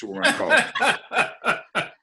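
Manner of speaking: high, slow, laughing through the name. Real, not acted. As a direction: giggle while announcing the joke name. Joking product name, laughing.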